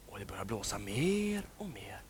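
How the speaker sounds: hushed storyteller whisper; suspense whisper. A narrator's reading. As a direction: breathe it like a secret. Storm rising, told to children.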